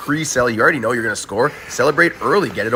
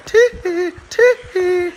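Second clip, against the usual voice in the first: squeaky high giggle yelps; high tee-hee; 4 dB louder, much slower than usual. Acted. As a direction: chirp it like a pop-star whoop. Moonwalk celebration, [name] hee-hee.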